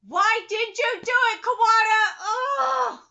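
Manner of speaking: loud frustrated cry at the author; exasperated shout. Real, not acted. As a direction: yell it in real frustration. Ranting at the author.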